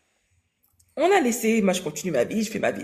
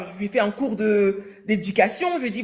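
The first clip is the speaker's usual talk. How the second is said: ordinary delivery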